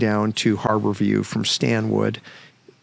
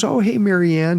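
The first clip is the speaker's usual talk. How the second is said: cheery greeting; 8 semitones higher, 3 dB louder than usual. Retold or imitated. Cheerful man greeting therapist, retold.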